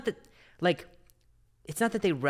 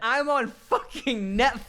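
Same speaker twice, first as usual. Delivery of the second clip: higher, slow mock of her indignant boast; mocking imitation; 9 semitones higher, slower than usual. Repeating his friend's bombing line back to her, slow and higher. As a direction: ham up her Netflix line to tease her. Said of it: "mimicking S0's line".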